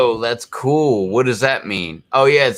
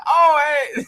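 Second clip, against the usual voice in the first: laughing quote. Retold or imitated. Friend acting out a movie bit, laughing high greeting. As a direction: crack up mid-line. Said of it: "He's like".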